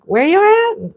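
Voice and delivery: high mock call from another room; lightly voiced husband calling out; high, playful imitation of a husband shouting for her; imitating husband calling. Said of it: "He's like".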